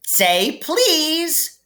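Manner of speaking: high, taunting voice; teasing poltergeist. Acted. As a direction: tease him in a high voice. Peeves teasing Filch.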